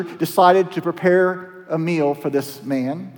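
usual speaking voice

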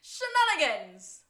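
hammed-up show-host cry; announcer shout. Shown as a demonstration. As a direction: bellow Shenanigans like a bar host's catchphrase. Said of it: "You say it".

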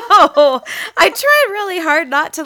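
laughing through the words. Real, not acted. Laughing at being teased.

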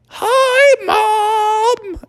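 very high, drawn-out call; child calling down. Acted. Child calls to mom from the sky, high call. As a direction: sing it out as if from far overhead. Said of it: "that's when she heard".

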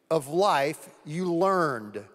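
drawn out slowly; slow, weighty reading of scripture; slowed emphasis. Acted. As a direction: lean on it, slow and deliberate. Reading scripture, slowing on key word.

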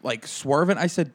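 usual speaking voice

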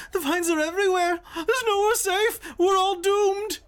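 put-on very high, wailing old woman; panicked old woman. Old woman grabbing a hero amid the vines, high panicked wail. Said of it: "An old woman grabs the arm".

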